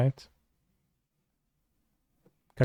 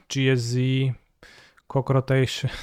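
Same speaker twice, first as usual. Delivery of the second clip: ordinary talk, nothing marked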